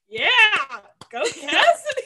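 high-pitched mock cheer with a laugh; imitated cheer. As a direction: cheer on a friend, hammed-up. Imitates a friend clapping and cheering.

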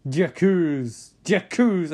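loud, drawn-out cry; acted accusation. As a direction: call it out like a dramatic accuser. Acted accusation, word called out.

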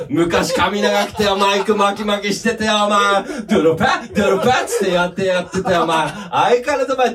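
high-pitched comic tirade; mock trash-talk. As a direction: pretend to slag off a beloved band, grumbling loosely. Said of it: "本気でけなして". (わざと悪態をつく)

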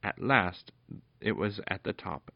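plain reading voice

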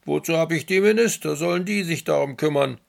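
a bored ruler grumbling aloud; grumbling emperor. Acted. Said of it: "brummte vor sich hin sinister".

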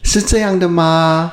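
put-on high, squeaky childish voice; baby voice. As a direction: talk like a little kid. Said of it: "今天我们要用娃娃音来主持节目吗".

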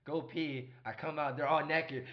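ordinary talk

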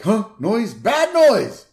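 high, loud panicked yelp; a dim dad startled by alarm; alarmed cartoon dad. Homer voiced, alarmed.

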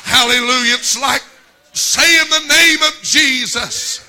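loud, high sung preaching; preaching at full force. Acted. Preacher rousing the congregation, loud half-sung whoop.

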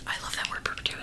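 hushed whisper savoring the word. Real, not acted. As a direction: whisper it lovingly.

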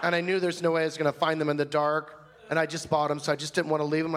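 ordinary delivery

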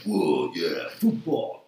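a dumb-jock grunt, lightly played; mock macho voice. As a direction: drop way low for a hammed-up jock "whoa". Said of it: "deepen my voice somehow".

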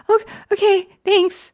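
high mock fan voice; nervous starstruck woman. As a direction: gush a nervous thank-you. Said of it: "such a good impression of".

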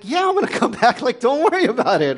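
high, loud, laughing brush-off; exasperated reassurance. Retold or imitated. Father brushing off his son's fear, high laughing reassurance. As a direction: toss it off brightly with a laugh. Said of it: "I'm like".

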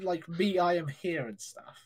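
usual speaking voice

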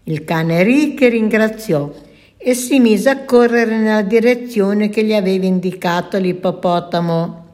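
ordinary delivery

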